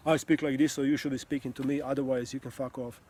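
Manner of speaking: sarcastic parody of an egotistical speaker; mock ego voice. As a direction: lampoon a know-it-all demanding you match him. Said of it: "this is not a battle of ego".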